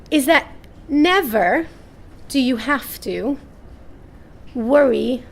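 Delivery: slow, broken phrasing with long pauses; hesitant take said in the wrong way; halting first try. Shown as a demonstration. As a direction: grope for the words, stopping between them. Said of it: "I'm saying this in the wrong way".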